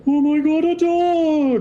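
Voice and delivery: very high, loud gushing squeal; excited squeal. Acted. Demonkin woman spots the dog, squealing high and loud. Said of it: "this large feminine voice is just kinda like".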